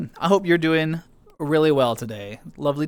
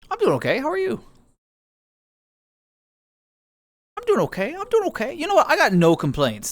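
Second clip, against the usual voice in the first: high squeaky bunny reply; tiny cartoon voice; 8 semitones higher than usual.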